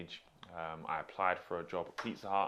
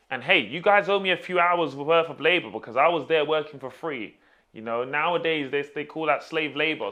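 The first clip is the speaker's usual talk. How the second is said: loud, high-pitched demand for back pay; mock indignation; 6 semitones higher, 9 dB louder than usual. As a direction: rant at the old employer, half in jest. Mock demand to former employer for pay.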